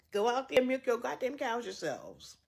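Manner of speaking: usual speaking voice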